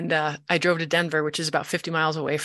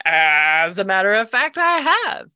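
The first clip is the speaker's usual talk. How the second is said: drawn-out, louder, climbing high at the end; dawning realisation; 3 semitones higher, 5 dB louder than usual. Retold or imitated. Patient answering practitioner's tick-bite question, dawning realisation. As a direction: stretch it out into a high finish.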